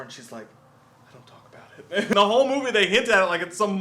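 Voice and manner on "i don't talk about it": hushed, secretive mock whisper; mysterious whisper. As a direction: whisper it like a guarded movie heroine. Bartender being mysterious about scar.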